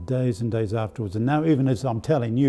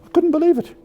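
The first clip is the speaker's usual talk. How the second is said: high, loud exclamation of disbelief; 8 dB louder, faster than usual. Retold or imitated. Student recalling being refused help, loudly incredulous. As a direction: exclaim it, pitch leaping right up.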